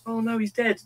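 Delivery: high imitated panic; father mimicking daughter sobbing at the film; mock distraught kid. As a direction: pitch up as the upset daughter. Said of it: "She was just like".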